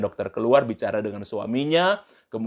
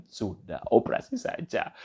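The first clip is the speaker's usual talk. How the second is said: very soft, hushed acted husband; soft reassurance; 6 dB quieter than usual. Husband telling his wife to accept surgery, soft reassurance. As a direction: drop the voice to settle her gently.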